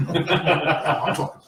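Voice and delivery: lightly put-on Jersey accent; a hint of a Jersey guy talking. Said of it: "I'm from Jersey".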